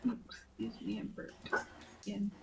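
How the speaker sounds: hushed, apologetic whisper. Real, not acted. Apologizing for burp on stream.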